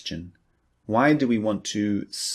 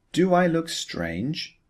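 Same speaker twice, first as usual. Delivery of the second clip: worried question; 9 semitones higher than usual. Acted. Paul asks in a dialogue.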